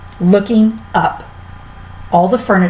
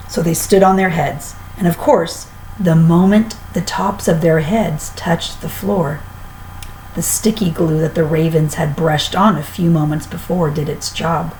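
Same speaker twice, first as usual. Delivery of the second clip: usual speaking voice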